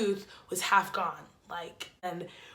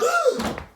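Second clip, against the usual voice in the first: shocked gasp; 4 dB louder than usual. Retold or imitated. Seeing broken tooth on phone.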